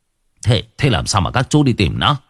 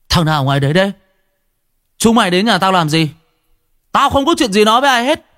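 hostile shout; 9 semitones higher, faster than usual. Acted. Quách shouting from inside house.

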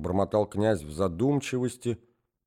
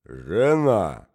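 pompous; 3 semitones lower, 5 dB louder than usual. A narrator's reading. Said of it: "с важностью начал".

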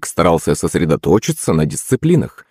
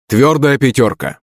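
raised-pitch verdict; approving mild shout; 6 semitones higher than usual. A narrator's reading. Teacher grading a successful pupil, calling out approval. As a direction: announce the top mark to the gym. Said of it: "крикнул Хрякин".

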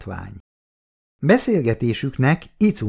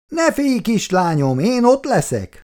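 higher pitched call back to daughter; 11 semitones higher than usual. A narrator's reading. Mother calling back to her daughter, higher call. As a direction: call it across the flat, cheerfully. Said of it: "jó hangosan szólt vissza neki".

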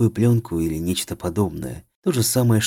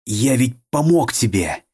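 louder, slow, insistent demand; 4 semitones higher, 5 dB louder, slower than usual. A narrator's reading. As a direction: press him for an answer. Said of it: "голос стал требовательным".

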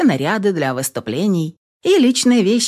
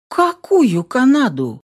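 taken aback; 6 semitones higher, slower than usual. A narrator's reading. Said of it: "опешила я".

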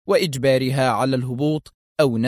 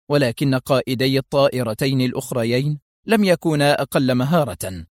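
usual speaking voice

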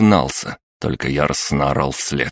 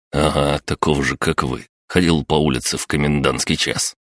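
plain reading voice

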